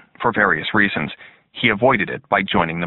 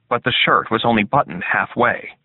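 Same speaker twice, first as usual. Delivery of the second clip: plain reading voice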